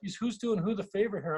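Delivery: high-pitched mock disbelief; pleasantly astonished. Host telling guest who really gained here, pleasantly astonished. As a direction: voice a sudden humbled realization. Voicing his own surprised thought.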